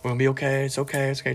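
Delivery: put-on soft, hushed soothing of a panicked child; hushed repeated it's okay, calming the family; calming reassurance. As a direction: settle them down gently, quietly. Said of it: "trying to be the calm".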